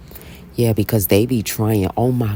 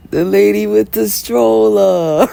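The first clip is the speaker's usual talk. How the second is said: high, loud, slow reveal; dramatic reveal; 10 semitones higher, 6 dB louder, slower than usual. Real, not acted. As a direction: reveal it in disbelief.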